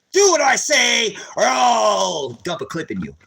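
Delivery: slow, shouted cop threat; loud harsh voice. Retold or imitated. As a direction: bark it like an armed cop. Imitating militarized cop threat.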